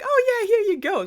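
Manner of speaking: very high, loud squeaky cartoon girl; hammed-up eager girl voice; obliging Rapunzel. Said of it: "so she was like".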